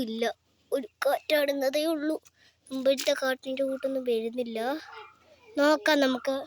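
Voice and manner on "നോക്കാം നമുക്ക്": normal talking voice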